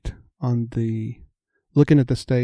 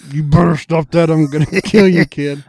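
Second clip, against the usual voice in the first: hammed-up threat from the stage, a laugh breaking in; playfully menacing warning; mock drummer threat; 3 semitones higher, 6 dB louder than usual. Drummer warning the heckling kid, in a mock threat.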